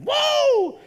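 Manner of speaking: put-on very high, loud baby whoop; high-energy baby. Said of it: "high-energy baby, is like".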